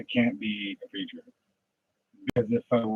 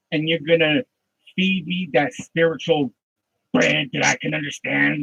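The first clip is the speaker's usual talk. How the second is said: loud, driving sermon voice; loud revival preacher, rising and hammering; preaching at full force; 6 semitones higher, 8 dB louder than usual. Acted. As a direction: preach it hard and loud to the pews. Preaching to an imagined congregation.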